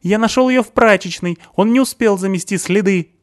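high, loud accusing voice of a panicky man; Stasik's shrill accusation. A narrator's reading. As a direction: point the finger and shout it high.

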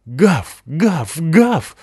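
loud, rising woofs; deafening barks. Acted. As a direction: bark loud to be heard. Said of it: "оглушительно высказался Рокки". (оглушительный лай)

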